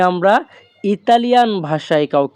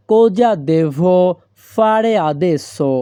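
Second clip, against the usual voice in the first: very slow, every syllable laid out clearly; slow teaching demo; much slower than usual. Shown as a demonstration. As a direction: spell the phrase out slowly for learners. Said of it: "এই বাক্যের Italian ভাষা হবে". (ধীরে ধীরে স্পষ্ট করে বলা)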